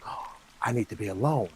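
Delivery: very soft, low withdrawn voice; retold withdrawn murmur. She tells her boss she needs to be alone, withdrawn murmur. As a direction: imitate someone pulling away, hushed and low. Retold distressed heroine refusing help.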